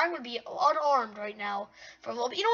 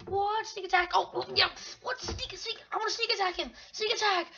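high-pitched excited play-acting; mock surprise attack; 6 semitones higher than usual. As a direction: act out a startled ambush. Acts out a sneak attack.

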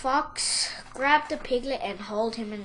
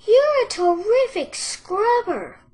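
high, loud piglet voice; high-pitched, lilting compliment; flattering piglet; 10 semitones higher, 10 dB louder than usual. A narrator's reading. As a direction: praise brightly in a high little voice. Piglet praises the fox.

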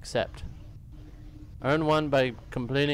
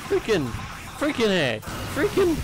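frustrated; 9 semitones higher than usual. Real, not acted. Frustrated during game.

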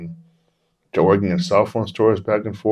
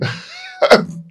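high burst of scoffing laughter; imitated mocking laughter. Police laughing at the claimed manager, mocking laugh. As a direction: laugh in the manager's face, as the cops. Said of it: "they start laughing".

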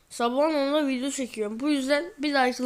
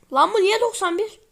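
higher, disbelieving question; can't believe the rating; indignant surprise; 7 semitones higher, 4 dB louder than usual. Real, not acted. Gamer baffled by a player's rating, high indignant surprise.

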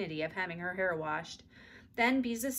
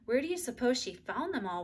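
plain reading voice

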